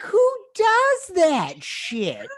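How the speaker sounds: very high, loud, drawn-out disbelief; outraged shout. Real, not acted. Host rants about the stolen resume, outraged shout. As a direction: shout it out, scandalised, stretching the words.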